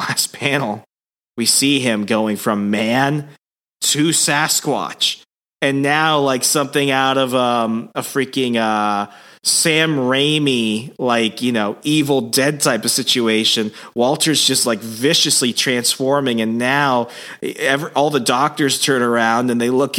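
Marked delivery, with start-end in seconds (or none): none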